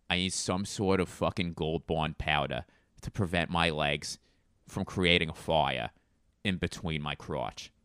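low-key Boston grumble, dropped R's; Boston accent. Acted. Bostonian complaining about chafing legs, muted accent.

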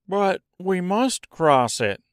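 high, insistent Scarecrow; Scarecrow's voice. Acted. Scarecrow argues with the voice.

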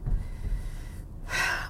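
a long weary breath out; tired sigh. Retold or imitated. Resigned to how dad always is, tired sigh. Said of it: "But I'm still kinda like".

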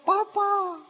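a hint of a frightened child; imitated child's cry. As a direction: lightly imitate a scared child calling out. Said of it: "Dia teriak".

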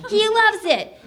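high, gleeful little alien; delighted high-pitched creature; excited aliens. A narrator's reading.

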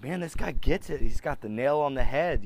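lightly hyped admiration; excited inner voice. Retold or imitated. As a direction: gush like he's just read the perfect post. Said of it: "I'm just like".

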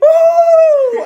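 high evil giggle; creepy childlike laugh. Pretend. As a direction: end it on a shrill creepy giggle. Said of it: "creepy ... childlike".

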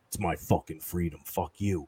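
low, soft sullen mumble; a hint of a grumbling freedom guy; mock anti-masker. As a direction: sneer it like a petulant anti-masker.

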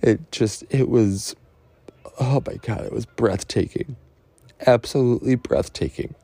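fighting back tears while speaking of the birth; getting emotional. Real, not acted. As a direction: voice lifting, choked up with real emotion. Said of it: "I'm sorry for getting emotional".

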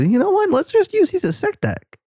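high squeaky producer voice; mock producer voice. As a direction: pitch way up as an eager producer. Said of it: "and they said".

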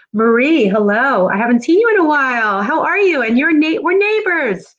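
high, excited, lilting hello; excited greeting. Real, not acted. Host greeting a neighbor who joined, excited. Greets a viewer she knows.

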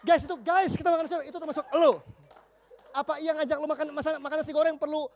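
loud, high, laughing exasperation; exasperated shout. Retold or imitated. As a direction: yell at the sulky kid in disbelief. Exasperated friends answering the sulker.

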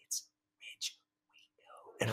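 whispered, awed announcement of the name; hushed whisper. Retold or imitated. Wife whispers to husband who is on the phone. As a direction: breathe the name like it's a ghost calling.

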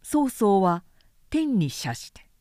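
ordinary delivery, nothing marked

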